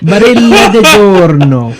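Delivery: high, loud hammed-up Italian brand name; mock Italian accent. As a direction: show off a put-on Italian accent. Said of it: "See? I sound Italian as fuck".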